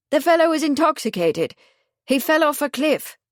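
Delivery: a lightly voiced Italian inventor protesting; Marconi, flustered. A narrator's reading.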